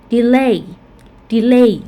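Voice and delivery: delay with the stress on LAY, falling; stress on second syllable. Shown as a demonstration. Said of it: "second syllable will have a primary stress".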